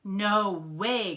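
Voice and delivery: loud, emphatic protest; crying refusal. A narrator's reading. Froggy rejecting the play date, loud refusal. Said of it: "cried Froggy".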